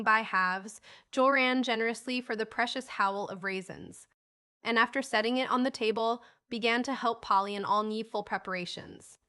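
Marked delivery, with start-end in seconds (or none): none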